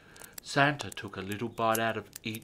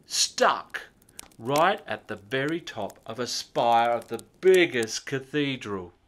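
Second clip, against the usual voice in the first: ordinary delivery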